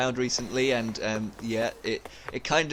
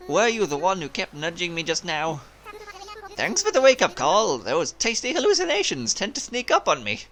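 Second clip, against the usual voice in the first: lilting, lightly Irish seagull sailor; lively Irish-tinged sailor's voice; Irish accent; 5 semitones higher, 4 dB louder than usual. Acted. Castaway seagull greets the one who woke him, lilting Irish accent. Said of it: "I made it a bit Irish".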